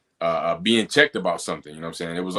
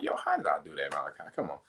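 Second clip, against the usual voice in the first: softer, muttering at his own clumsiness; quiet self-scolding; 5 semitones lower, 9 dB quieter, faster than usual. Retold or imitated. On the phone, quietly scolding himself over a spilled drink. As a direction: grumble at yourself after spilling a drink.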